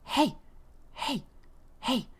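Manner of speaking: high, short, repeated heys; insistent spirit voice. Retold or imitated. Unseen spirit calling a sleeper over and over, insistent. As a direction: imitate a voice nagging for attention. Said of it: "It's like they're jumping back and forth".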